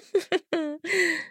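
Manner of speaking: high tinkling giggle; a light fairy laugh. A narrator's reading.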